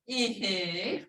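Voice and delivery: Ee-hee, soft and drawn out; ihi for yes. Shown as a demonstration. Teacher shows how to say yes, soft and long. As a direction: let Ee-hee slide softly. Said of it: "yes, you go".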